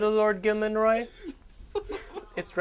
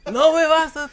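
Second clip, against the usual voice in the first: higher, louder Spanish taunt; mock Spanish bandit; 10 semitones higher, 6 dB louder than usual. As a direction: sneer like a cartoon bandit.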